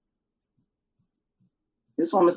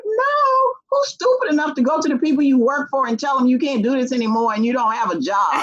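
exasperated outburst; 6 semitones higher than usual. Retold or imitated.

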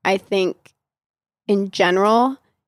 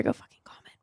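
whispered playful threat; whispering; 12 dB quieter, faster than usual. Real, not acted. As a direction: whisper it to the listeners. Playful aside to listeners.

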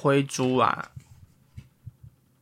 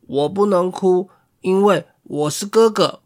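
proud little puppy; 4 semitones higher, 5 dB louder than usual. Acted. Puppy boasting it won't cry.

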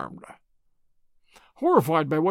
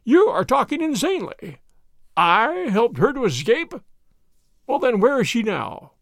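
higher-pitched, agitated denial; indignant old professor; 5 semitones higher, 4 dB louder than usual. Acted. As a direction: protest the accusation in a raised voice. Accused professor protests to Holmes.

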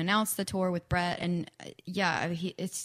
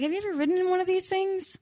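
put-on quick, higher, half-hearted Australian accent; attempted Australian accent; 13 semitones higher, 3 dB louder, faster than usual. [name] asking if she's ridden one, attempted Australian accent. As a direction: rattle it off in a rough Australian accent. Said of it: "I can't do an Australian accent".

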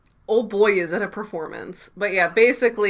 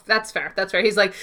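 ordinary talk

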